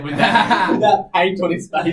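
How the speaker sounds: laughing. Real, not acted. Laughing while teasing.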